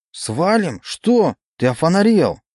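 high, shocked disbelief; what, are you crazy; incredulous. Acted. Friend shocked by request.

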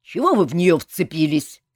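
loud demand from a commander; angry rebuke. A narrator's reading. Colonel challenges those holding Saeta, angry rebuke. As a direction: demand an explanation, loudly.

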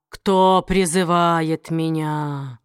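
low, slow, cracking like broken glass; a slow rasp like shattering glass; demon voice. Acted. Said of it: "Голос демона напоминал треск разбитого стекла".

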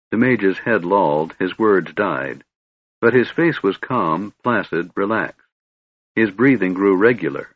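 plain reading voice

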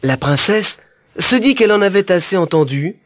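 ordinary delivery, nothing marked

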